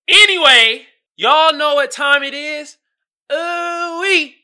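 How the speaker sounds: loud, high, drawn-out hype; excited sing-out to the audience; hyped segment announcement. Pretend. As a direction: announce it big, stretching the words.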